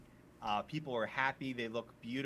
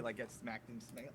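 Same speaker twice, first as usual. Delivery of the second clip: ordinary delivery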